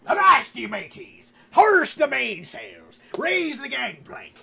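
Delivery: high, loud, slow pirate bellow; pirate captain shout. Acted. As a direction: bellow orders like a ship captain. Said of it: "I don't know what I'm talking about".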